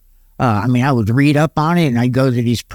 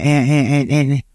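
ordinary talk

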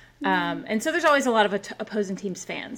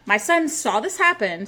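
normal talking voice

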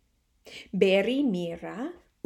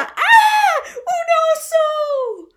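high, loud shriek of alarm; a mother screaming at a bear in the window; frightened mother shrieking at the bear; scared scream; 8 dB louder, slower than usual. Acted.

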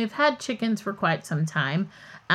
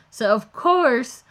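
ordinary delivery

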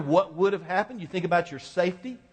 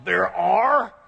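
surprised; 3 semitones higher, 7 dB louder than usual. Retold or imitated. Pastor surprised by deacon's call.

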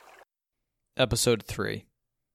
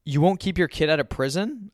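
higher-pitched, mildly indignant question; pleading challenge, pitched up; incredulous protest; 6 semitones higher, 4 dB louder than usual. A narrator's reading. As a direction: press dad in disbelief. Son pleading angrily with his dad.

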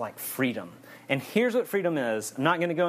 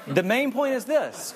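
higher, slow, laughing through it; 10 semitones higher, 3 dB louder than usual. Real, not acted. Preacher recovering from a joke, laughing. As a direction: still laughing at the last joke.

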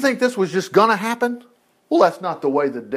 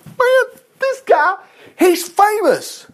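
excited; 14 semitones higher, much slower than usual. Real, not acted. Excited at spotting Isaiah.

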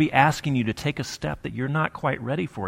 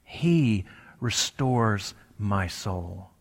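slow, with He set apart and leaned on; stress on He; 3 semitones lower, 3 dB quieter, much slower than usual. Shown as a demonstration. Preacher demonstrating emphasis on different words, He stressed slowly. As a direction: lean on He, then slowly finish the phrase. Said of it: "emphasize different parts of it separately".

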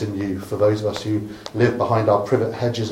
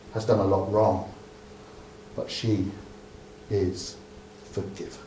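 gentle forgiveness; 4 semitones lower, 12 dB quieter than usual. Acted. Jesus speaks tenderly of woman.

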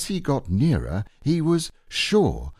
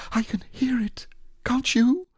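high, hushed, worried; anxious whisper. Acted. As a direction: whisper it nervously. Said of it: "said Piglet anxiously".

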